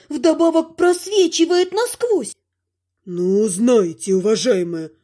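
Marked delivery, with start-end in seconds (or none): 3.1-4.9 a deep ghost, hurt and huffy; offended ghost. Acted. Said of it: "Обиженно взвыли". (обиженный голос призрака)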